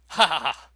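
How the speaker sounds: loud, scornful villain's laugh; mocking laugh. Father laughing off the accusation, loud scornful laugh. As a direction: laugh him off with contempt.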